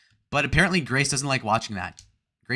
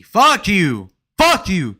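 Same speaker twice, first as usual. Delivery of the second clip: high, loud outburst; angry shouting; 8 semitones higher, 11 dB louder, much slower than usual. Real, not acted. Streamer answering an insult to his quarterback, shouting. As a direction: yell it back at them.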